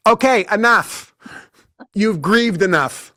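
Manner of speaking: slow, loud, firm putdown; impatient verdict. Real, not acted. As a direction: lay it down slowly like a final word. Impatient verdict on the grieving woman.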